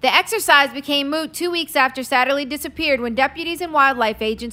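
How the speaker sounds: ordinary delivery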